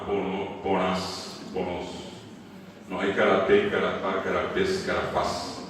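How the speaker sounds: normal talking voice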